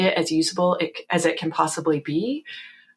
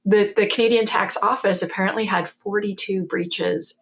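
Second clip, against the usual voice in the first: usual speaking voice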